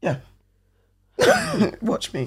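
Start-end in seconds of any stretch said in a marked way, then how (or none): 1.2-1.8 short high laugh. Real, not acted. Joking about becoming Thanos.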